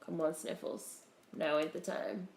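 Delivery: normal talking voice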